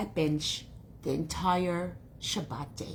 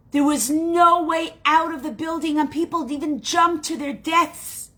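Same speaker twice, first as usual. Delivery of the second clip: high, loud, anguished telling; horrified report; 7 semitones higher, 6 dB louder than usual. Acted. Cousin tells Rose about the factory fire, horrified. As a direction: break terrible news with heavy, urgent emphasis.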